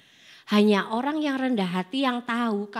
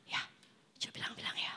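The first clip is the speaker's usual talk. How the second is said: breathy whispered aside; 20 dB quieter, faster than usual. Real, not acted. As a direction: whisper it like a secret.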